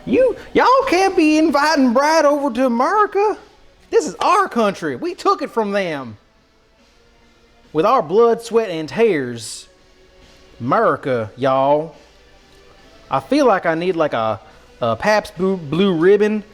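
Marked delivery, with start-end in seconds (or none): 0.0-12.0 high-pitched country indignation; mock patriotic outrage. Country streamer scolding raiders over America, mock outrage. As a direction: protest like an affronted backwoods patriot.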